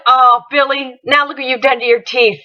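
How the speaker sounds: normal talking voice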